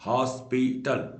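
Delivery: Hospital slowed into three distinct beats; syllable by syllable. Shown as a demonstration. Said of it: "तीन बार word टूटा".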